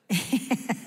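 short amused laugh; light laugh. Real, not acted. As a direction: laugh at your own joke. Laughing at her own joke.

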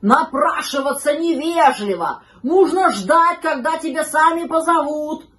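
loud, high scolding; outraged, emphatic telling-off; indignant dog. A narrator's reading. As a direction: lecture the pup with indignation. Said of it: "возмутился пёс".

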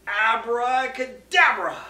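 drawn-out loud Abracadabra; booming magic word. Acted. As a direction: call the spell slowly and loudly.

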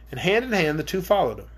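usual speaking voice

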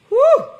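high, loud exhale of relief; loud bracing whew before a touchy topic. Real, not acted. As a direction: blow out a big whew. Big topic announced.

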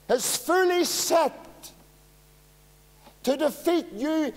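high, loud, ringing preacher's cry; preaching at full force. Acted. Preacher warning his flock of the devil, full-force preaching. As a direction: thunder it out to the whole congregation.

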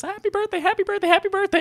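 high, sing-song mock birthday wishes; mimicking the work chat's endless birthday messages; mocking group chat. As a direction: chirp it like a string of cheery texts. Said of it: "starts coming in and says".

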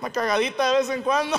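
high-pitched mocking call; yelled taunt. He taunts his former crushes, mocking holler. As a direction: keep heckling them, voice way up high.

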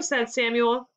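ordinary delivery

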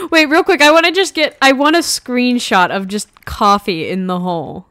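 ordinary delivery

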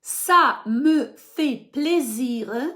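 very slow model. Shown as a demonstration. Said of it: "slow model for repetition".

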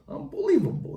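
high unbelievable; high, astonished catchphrase; excited exclamation. Real, not acted. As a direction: lift it up high, amazed.

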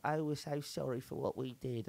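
imitating a contrite English apologiser; mock apologetic English. Mocking English people sorry for empire, apologetic English accent. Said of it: "you have a lot of people being like".

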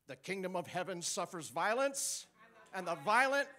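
building to a loud proclamation; preaching the verse. Acted. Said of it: "you know this verse".

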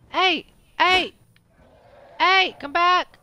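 loud, high yell to call it back; shouting after it. Real, not acted. Player yelling at the fleeing dragon, loud and high. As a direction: holler at the dragon to come back.

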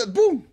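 sudden loud shouted bum; loud imitated slam; door slam bang. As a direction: mimic the door slamming with a high, loud burst. Door slamming shut, imitated bang.

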